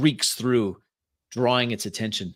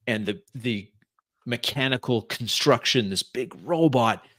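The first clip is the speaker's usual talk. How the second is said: ordinary talk, nothing marked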